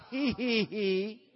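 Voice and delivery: high, loud "hee, hee, hee"; demonstrated giggle. Shown as a demonstration. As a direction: lead the crowd in a deliberate tee-hee. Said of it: "Somebody say".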